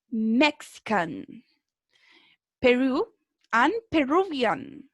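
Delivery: slow, carefully spaced word-by-word model reading; pronunciation drill. Shown as a demonstration. Teacher reading out vocabulary slowly to a class for pronunciation practice. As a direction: read each country and nationality slowly so students can copy it.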